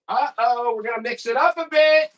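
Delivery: high-pitched teasing announcement; playful hype. Real, not acted. Seller playfully announces a change.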